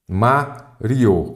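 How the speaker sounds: "Mario" broken into two syllables, Ma-rio; split into syllables. Shown as a demonstration. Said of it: "separar Mário em sílabas". ("Mario" separado em duas sílabas, Ma-rio)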